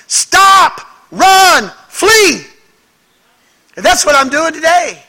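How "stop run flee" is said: high, loud alarm shouts; shouting a warning. Pretend. Preacher shouting a warning at his congregation, loud. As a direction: cry out to stop them. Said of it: "someone shout at us".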